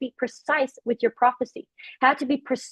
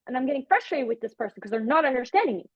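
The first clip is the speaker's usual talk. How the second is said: normal talking voice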